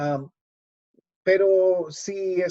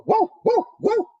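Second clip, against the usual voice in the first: very high, loud yapping guau guau; dog bark imitation; 7 dB louder than usual. Shown as a demonstration. Teacher barking in Spanish for the class, very high yaps. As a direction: yap like a small dog. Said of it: "¿Sí o no?".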